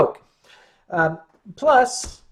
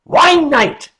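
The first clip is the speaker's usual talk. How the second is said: loud punchline; 5 semitones higher, 9 dB louder than usual. Real, not acted. Punning on Weihnacht.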